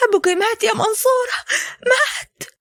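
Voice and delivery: high, strained voice breaking the bad news; grief-stricken news. Mother telling her daughter on the phone that her father died, high and grieving.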